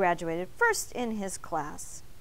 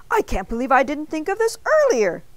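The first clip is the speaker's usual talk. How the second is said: panting; 5 semitones higher, 7 dB louder than usual. A narrator's reading. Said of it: "Mister Bing panted".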